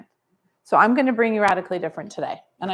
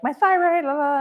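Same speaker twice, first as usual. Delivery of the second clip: higher, sing-song mock of a patient's complaints; a hint of a whiny patient, pitched up; mock complaining patient; 6 semitones higher than usual. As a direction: lightly parody a patient listing symptoms. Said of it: "they'd be like".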